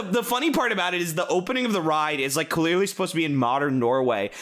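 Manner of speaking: ordinary talk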